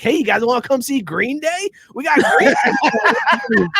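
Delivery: high, slow, sing-song mock local welcoming tourists; goofy Lake Tahoe voice. Joking about Lake Tahoe luring fans, goofy high mock local voice. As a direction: ham up a chirpy small-town host voice. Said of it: "Did they talk like that in Lake Tahoe".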